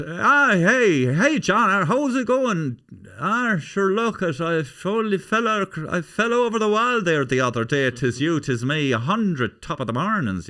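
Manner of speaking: high, loud, hammed-up mock Irish; stage Irish accent. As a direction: do a caricature Irish brogue, lilting and over-excited. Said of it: "I think the majority of Americans".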